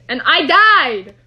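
loud, half-crying frustrated wail; frustrated outburst. Real, not acted. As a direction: yell out your annoyance at dying. Game death, real frustration.

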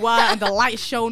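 slow, loud churchy proclamation; hamming up a preacher's awe. Retold or imitated. Mocking how Christians talk, slow and loud. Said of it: "You know how these Christians talk about".

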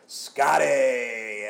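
loud happy high shout; excited greeting shout. Retold or imitated. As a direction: call out a name gleefully. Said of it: "he just goes".

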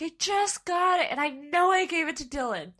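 retold upset, high-pitched; upset protest. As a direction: relive being upset, pitch jumping up on the complaint. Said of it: "I remember being so upset".

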